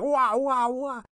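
high, wailing "wah wah wah" sound-wave noise; imitated sound waves. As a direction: wail the sound blast up high like a siren. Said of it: "And Finn's going like".

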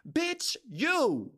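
a sharp indignant cut-off; indignant outburst. Real, not acted. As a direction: snap it out in disbelief. Angry rant at lazy coworkers.

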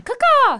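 squeaky bird call caw-caw; a high chirping crow cry; high-pitched caw-caw. Shown as a demonstration. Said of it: "Maybe that's mine".

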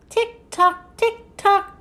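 high, evenly spaced tick-tock; high-pitched mechanical clock imitation. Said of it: "sound like a clock".

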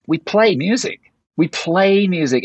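"play" pushed up high each time; "play" lifted and stretched as the key word; stress on PLAY. Shown as a demonstration. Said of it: "people forget this word".

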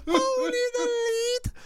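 high, slow laughing mock echo; laughing mockery. Real, not acted. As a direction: crack up while mocking. Mocking, laughing echo of boast.

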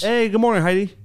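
high, loud cheery hello; cheery greeting. Pretend. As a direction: greet her brightly at lunch. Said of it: "let's say we're having lunch".

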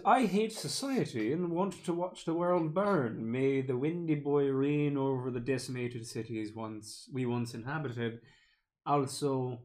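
put-on slow, grand Irish accent. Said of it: "once I start with the accents".

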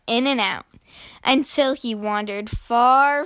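ordinary delivery

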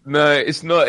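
high giggling, gasping between words; catching breath midword. Real, not acted. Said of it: "It's broken me".

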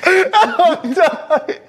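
high-pitched, helpless real laughter; laughing to tears. Real, not acted. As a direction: squeeze the words out through uncontrollable laughing. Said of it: "I'm crying".

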